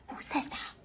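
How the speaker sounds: incredulous whisper; hushed, baffled aside. Real, not acted. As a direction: breathe it in disbelief. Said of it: "unvoiced whisper".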